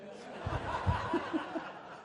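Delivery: breathy unvoiced laugh; a quiet airy chuckle; short silent laugh; soft laugh. Real, not acted. Teacher laughs at drill joke.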